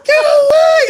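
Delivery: high, loud shriek imitating a girlfriend; acted desperate scream. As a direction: scream it as if fighting off captors. Acts out girlfriend struggling while held.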